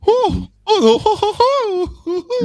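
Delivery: high, far-off pompous posh "oh, oh"; pompous English accent. Retold or imitated. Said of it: "The worst English accent is Piers Morgan".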